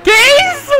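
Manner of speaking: sudden high yelp of shock; shocked shout. Real, not acted. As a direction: cry out in disbelief. Car crashes into police car.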